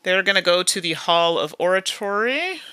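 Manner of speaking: usual speaking voice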